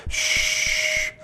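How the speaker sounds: imitated hiss of the mask's air. Man in the dust imitating the mask's hiss.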